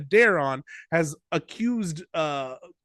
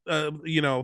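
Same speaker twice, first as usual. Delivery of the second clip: usual speaking voice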